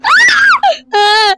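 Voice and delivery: very high crying shrieks; terrified screams. Real, not acted. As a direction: scream in fright. Caught by monster.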